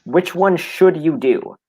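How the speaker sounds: slow, deliberate question; insistent questioning. Real, not acted. Debater presses opponent for an answer, insistent questioning. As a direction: space the words out to press for an answer.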